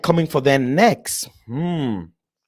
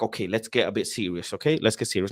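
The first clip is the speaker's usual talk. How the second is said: mock serious tone; 7 semitones lower, 8 dB quieter than usual. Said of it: "That's the environment where you're like".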